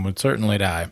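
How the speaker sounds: ordinary talk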